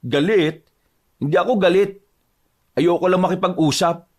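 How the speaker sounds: angry while insisting he isn't; irritated denial. Acted. Husband denying to his wife that he's angry, irritated. Husband denies anger in quarrel.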